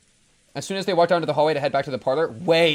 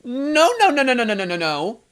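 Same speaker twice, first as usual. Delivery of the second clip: panicked; 8 semitones higher, 5 dB louder than usual. Real, not acted. Panicked denial.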